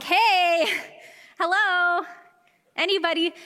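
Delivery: strut it vocally to get noticed; peacocking for attention. Retold or imitated. To ignoring baristas, hammed-up showy call for attention. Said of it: "peacock it".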